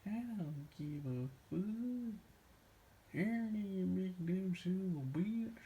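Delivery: slow put-on impression of a friend; imitating friend's catchphrase. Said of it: "catchphrases he would say".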